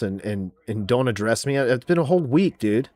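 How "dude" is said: ordinary talk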